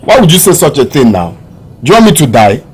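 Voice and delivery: loud, incredulous echo of the advice; forceful, wounded objection to mother; indignant protest. Acted. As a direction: throw it back at her, outraged. Son outraged at mother's advice.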